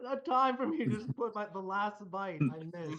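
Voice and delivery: squeaky mock self; high silly voice. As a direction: pitch it way up, playful. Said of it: "I was like".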